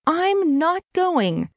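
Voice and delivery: high, drawn-out protest; defiant, upset refusal. Acted. Brittany refuses, in tears.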